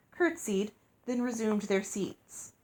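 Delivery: ordinary delivery, nothing marked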